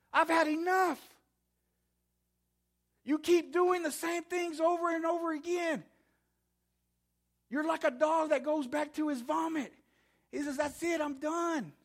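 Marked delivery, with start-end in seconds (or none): 0.1-9.7 high-pitched exasperation as God; fed-up rebuke. Acted. God fed up with his people. 10.6-11.7 high, drawn-out exasperation; fed-up declaration. Acted. God gives up on them.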